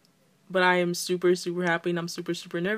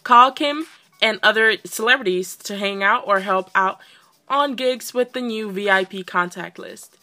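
loud, emphatic reading of update notes; hyped-up announcement reading; excited read-aloud; 3 semitones higher, 9 dB louder than usual. Real, not acted.

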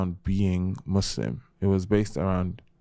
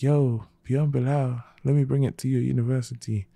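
mock self-promotion; 6 semitones higher than usual. Mocking pushy self-promotion.

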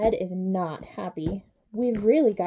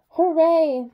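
high, loud cheer; 6 semitones higher, 6 dB louder than usual. A narrator's reading. Narrator cheering as the goose goes free. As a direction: cheer with the children.

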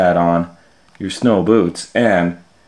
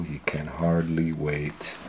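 plain reading voice

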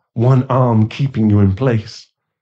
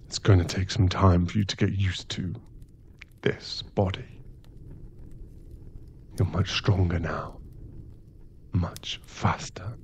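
hushed; 2 semitones lower, 10 dB quieter than usual. Acted. Regretful vampire speaks to turned victim.

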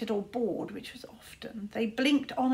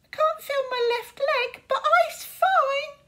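very high, loud squeak, tearful and brave; squeaky sprite; 8 dB louder, slower than usual. Acted. Injured sprite reassures Tsar, very high squeaky brave voice. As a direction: squeak it out like a tiny hurt sprite, slowing at the end. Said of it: "squeaked Squeeze Juice".

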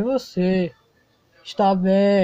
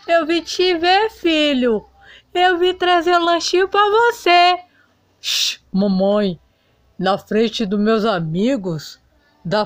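higher, pleading voice of a wounded mother; hurt mother; 6 semitones higher than usual. Acted. Griselda pleads with her embarrassed son at college, hurt and high. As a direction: she reminds him of all she gave up.

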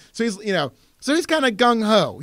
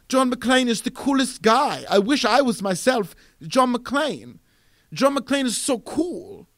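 gushing fan in a put-on European accent; higher-pitched, broad European accent; 5 semitones higher than usual. Director gushing about his hero John McClane, European accent. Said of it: "I'll just do a European accent".

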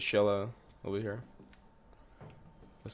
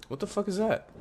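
startled; 11 semitones higher than usual. Real, not acted. Sudden scare in horror game.

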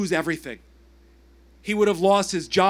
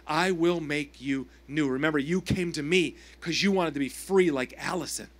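Jesus speaking; 6 semitones lower than usual. Acted. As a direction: speak as a gentle, steady Jesus. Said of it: "Jesus says to him".

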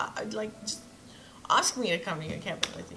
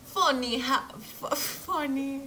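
higher, exaggerated accent attempt on "Funny"; trying out an accent; 5 semitones higher, 4 dB louder, slower than usual. Shown as a demonstration. Game player hunting for the character's voice, trying out an accent. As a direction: fumble "funny" in a put-on accent. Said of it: "How would they say".

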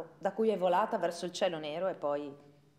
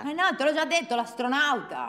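put-on annoyed child; 6 semitones higher, 6 dB louder than usual. Child annoyed by parent's question.

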